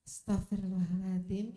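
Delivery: low, self-soothing prayer murmur; istighfar to calm down. Shown as a demonstration. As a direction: demonstrate calming yourself with a low istighfar. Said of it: "syukur-syukur ditambahi istighfar".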